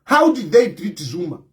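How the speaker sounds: normal talking voice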